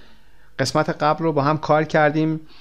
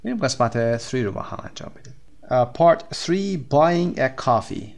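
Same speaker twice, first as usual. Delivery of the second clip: ordinary talk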